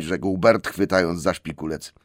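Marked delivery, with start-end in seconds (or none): none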